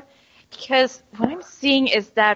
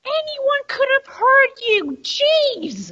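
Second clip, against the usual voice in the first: exasperated scolding; slower than usual. Acted.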